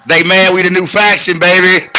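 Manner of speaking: high, loud bragging hype; crowing that they're the new faction; hype brag. Acted. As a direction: boast it to the crowd.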